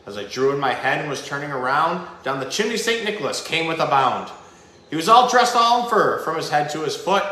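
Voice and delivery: lively Christmas story read aloud, building in energy; storybook reading. A narrator's reading. Host reading The Night Before Christmas to viewers, storybook narration. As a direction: read the classic poem like a bedtime story.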